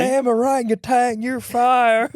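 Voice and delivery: high, urgent cartoon bear; Baloo. Bear warning man cub about the orangutan, high character voice. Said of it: "He's convincing".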